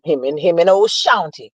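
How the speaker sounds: ordinary talk, nothing marked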